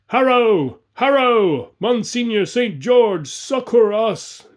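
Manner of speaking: high, loud, drawn-out plea; shouted cry for help. A narrator's reading. Archers calling on Saint George, shouted cry for help. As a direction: cry for aid over the din. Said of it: "thousands shouting".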